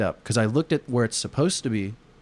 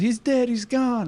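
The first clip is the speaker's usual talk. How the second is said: much higher, slow wailing cry; hammed-up panic; 13 semitones higher, slower than usual. Soldiers wailing that the general was dead, much higher and slow. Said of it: "Everybody was like".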